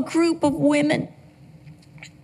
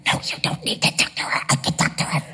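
whispered; 11 semitones lower, 3 dB quieter, faster than usual. Retold or imitated. Telling husband not to call.

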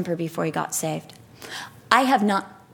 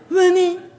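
high-pitched imitation of a snippy spat; hammed-up bossy squabble; mock quarrel; 9 semitones higher, 4 dB louder, slower than usual. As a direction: trade rude orders in an exaggerated high voice. Replays heated exchange with cyclist.

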